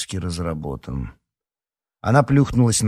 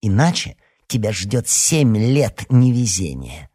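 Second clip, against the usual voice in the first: slow, drawn-out threat; ominous; 3 semitones higher, 4 dB louder, slower than usual. A narrator's reading. Alyona threatens Larisa with bad luck, ominous. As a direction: pronounce it like a slow curse. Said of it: "зловеще прищурилась Алёна".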